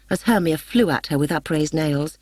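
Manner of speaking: usual speaking voice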